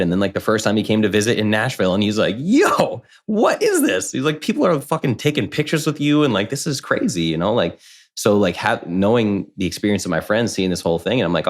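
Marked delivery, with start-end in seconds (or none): 2.4-7.2 high, amazed disbelief; amazed friend. Retold or imitated. Friend visiting Nashville, amazed by people taking pictures, high disbelief. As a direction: voice the friend's stunned surprise.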